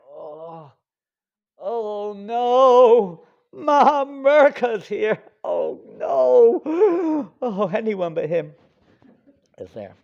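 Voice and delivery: high, loud wailing dismay; dismayed dying king. Acted. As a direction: wail as the fool you dread appears. King sees the fool.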